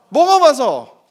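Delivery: high, loud answer; loud call-out. Real, not acted. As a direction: call it out to the room. Congregation answer echoed loudly.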